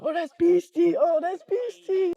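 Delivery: high, loud excited exclamation; amazed hype. Acted. Friend reacting to something super cool, high loud hype. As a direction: squeal it out in hyped-up admiration. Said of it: "if something was super cool, you'd be like".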